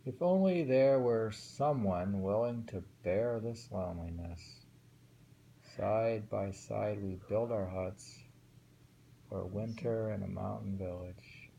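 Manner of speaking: measured recitation of a waka; reading a winter poem aloud to a friend. Shown as a demonstration. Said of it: "reads poem aloud".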